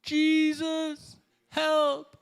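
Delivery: hammed-up wail to Jesus for help; helpless cry. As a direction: play the fallen sheep calling out. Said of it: "We fall and we're like".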